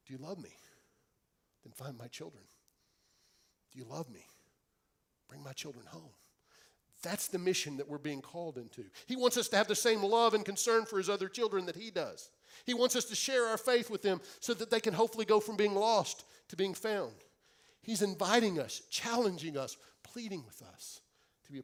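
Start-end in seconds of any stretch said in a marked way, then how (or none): 0.1-6.1 tender plea. Acted. Jesus pleading with each listener, tender and soft. 9.1-17.2 louder, higher, preaching with building fervour; preaching at full force. Acted. Preacher urging the congregation, full-force preaching.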